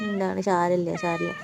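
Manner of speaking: soft, slow hushing to a scared kitten; quietly calm the frightened cat; there, there, softly and slowly; soothing hush. Real, not acted.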